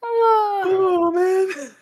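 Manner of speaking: high, strained laughing groan; wheezing out a pained laugh; laughing through pain. Real, not acted. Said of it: "it hurts to laugh".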